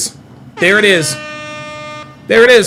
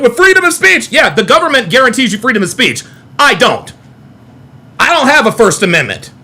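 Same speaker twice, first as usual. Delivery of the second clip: ordinary delivery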